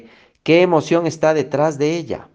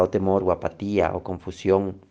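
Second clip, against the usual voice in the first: normal talking voice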